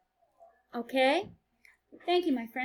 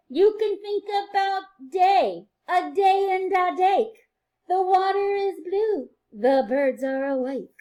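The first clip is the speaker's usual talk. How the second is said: bright, sing-song read-aloud for little ones; reading to children; 8 semitones higher, 9 dB louder, slower than usual. A narrator's reading. Teacher reading a rhyming book to her class, sing-song and lively.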